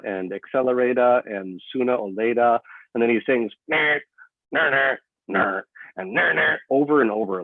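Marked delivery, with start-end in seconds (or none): 3.7-6.6 sung ner ner guitar riff, high and slow; vocal electric guitar noises; electric guitar imitation. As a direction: mimic a guitar riff with your voice. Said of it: "like he's imitating an electric guitar".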